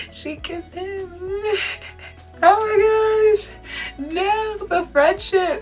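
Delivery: high, slow squealing gush. Real, not acted. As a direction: swoon over the kiss. Gushing over the TV kiss.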